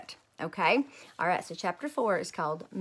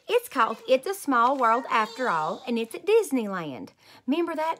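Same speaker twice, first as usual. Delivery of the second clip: put-on loud, higher, bursting little girl; excited kid idea; 4 semitones higher, 5 dB louder than usual. A narrator's reading. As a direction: blurt out a great idea. Junie B excited about idea.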